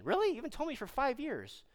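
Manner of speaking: high-pitched mock disbelief; incredulous neighbour. Neighbour learns of the faith five years late, high and incredulous. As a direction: act out a surprised neighbour, pitch jumping up.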